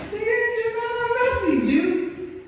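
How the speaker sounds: mock stoned friend laughing. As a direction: laugh it off like a high buddy.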